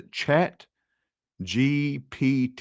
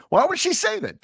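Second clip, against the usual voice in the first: much higher, mock indignant question; mock outraged squeal; faster than usual. Streamer mock-complaining about her husband's "totes", much higher and indignant.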